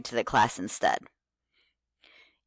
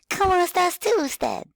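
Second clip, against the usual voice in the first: put-on high eager little kid voice; 3 dB louder than usual. Shown as a demonstration. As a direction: squeak it like an excited child. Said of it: "talk very enthusiastically with a kid voice".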